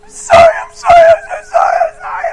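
very high, loud mock wail; retold bawling apology. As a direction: blubber it out in an exaggerated squeal. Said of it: "And now she's bawling".

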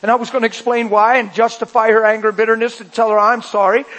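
ordinary talk, nothing marked

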